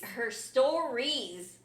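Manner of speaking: STORIES hugely stretched out; very slow, savoured STORIES; capitalized STORIES. Retold or imitated. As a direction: draw STORIES out very slowly. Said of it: "She capitalized stories".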